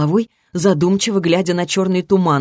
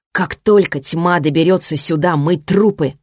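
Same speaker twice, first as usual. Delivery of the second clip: usual speaking voice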